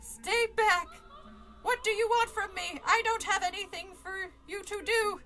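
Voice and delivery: high, loud panicked pleas; a hammed-up scared voice; frightened pleading. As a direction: back away from the threat, begging. Voicing scared character lines.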